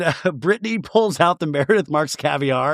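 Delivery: usual speaking voice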